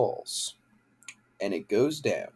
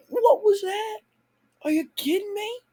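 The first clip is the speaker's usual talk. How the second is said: outraged disbelief. Real, not acted. Outraged at the show.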